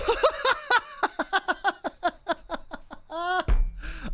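high, long helpless laugh; big laugh. Real, not acted. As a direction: crack up at your own blunder. Laughs at his own mistake.

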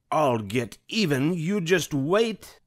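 high theatrical Cruella threat; furious Cruella. A narrator's reading. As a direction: hiss out a vow of revenge. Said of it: "she screamed".

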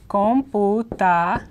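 slow, spaced com-pu-ta in Computa with a pure O; syllable-by-syllable Spanish. Shown as a demonstration. Teacher breaking down the word for computer, slow spaced Computa. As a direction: sound out Computa one syllable at a time. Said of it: "O in Spanish is always O".

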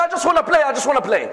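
high-pitched wheedling, lightly acted; childlike pleading. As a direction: plead innocently, starting high then easing down.

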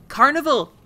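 thrilled shout of the word; delighted exclamation; excited. Real, not acted. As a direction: burst out with delight. Excited gasp at carnival area.

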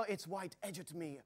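usual speaking voice

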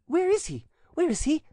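very high, excited damsel; eager questioning. A narrator's reading. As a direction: ask urgently in a very high voice. Cousin eagerly seeks the friar.

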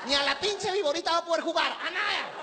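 high, shrill-edged mock stewardess scolding; angry flight attendant. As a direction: play the fed-up flight attendant barking orders. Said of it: "la sobrecargo te ve y te, ay, se encabrona".

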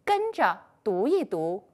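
ordinary delivery

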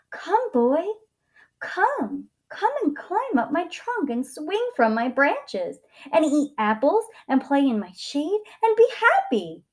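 high, lively, inviting tree voice; eager tree. A narrator's reading. Tree inviting the boy to play, eager and high. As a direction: call out brightly as a welcoming tree.

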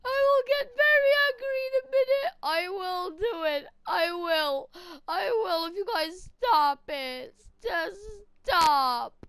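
loud, high tearful yelling; angry crying. Acted. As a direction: scream it through tears at the bullies. Picked on, threatens to get angry.

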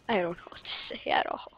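hushed unvoiced whisper. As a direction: whisper it like a secret. Jokey whisper, then "just kidding.

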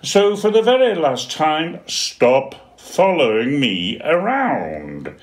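high, loud, fed-up shout, slowing at the end; exasperated penguin. Acted. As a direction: lay down the law one last time. Dudley loses patience.

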